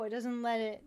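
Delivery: ordinary delivery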